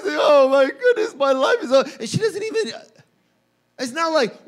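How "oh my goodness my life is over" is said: high, loud, hammed-up despair; mock crying wail. Preacher imitating Mary crying before two angels, mock wail. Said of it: "and she's crying".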